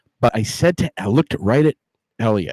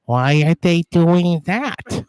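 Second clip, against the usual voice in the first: puzzled mom quote; 5 semitones higher, slower than usual. Retold or imitated. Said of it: "And she says".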